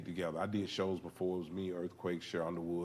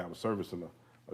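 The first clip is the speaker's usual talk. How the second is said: normal talking voice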